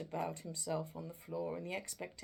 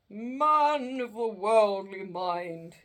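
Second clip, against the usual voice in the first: ghost voice; 3 semitones higher, 12 dB louder, slower than usual. Acted. Ghost speaks to Scrooge.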